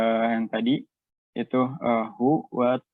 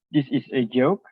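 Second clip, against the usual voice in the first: statement turned question by a rise on "joke"; "This is a joke" asked with a rising tone; rising question intonation. Shown as a demonstration. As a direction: let "joke" climb at the end. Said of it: "spoken with a rising tone".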